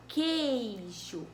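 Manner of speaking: "queijo" said slowly with the u silent, as kay-zho; "queijo" slow, only the e and i sounded. Shown as a demonstration. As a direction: draw out "queijo" and drop the u. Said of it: "a gente só fala".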